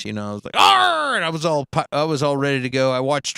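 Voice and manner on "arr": loud, high pirate "arr"; drawn-out mock pirate roar; pirate growl. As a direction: belt out a hammed-up pirate cry. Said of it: "a pirate voice".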